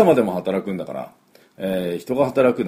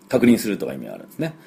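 normal talking voice

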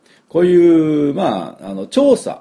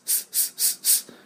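S sound demo; 12 dB quieter, much slower than usual. Shown as a demonstration. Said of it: "この三つをしっかり出さないと".

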